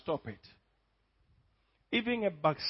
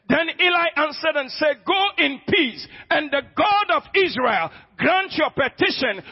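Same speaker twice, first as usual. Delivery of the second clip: shouted, higher sermon at full power; preaching at full force; 5 semitones higher, 5 dB louder than usual. Acted. Pastor to congregation, booming sermon retelling Hannah. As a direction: preach it out loud to the whole church.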